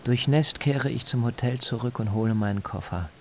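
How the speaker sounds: usual speaking voice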